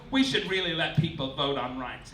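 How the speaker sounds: usual speaking voice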